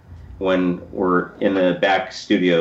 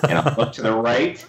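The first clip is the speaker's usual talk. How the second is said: usual speaking voice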